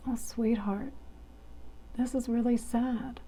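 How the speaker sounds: soft, whispered tender comfort; gently name the sadness; hushed caring voice; soothing whisper. Shown as a demonstration. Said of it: "kind and caring and soothing".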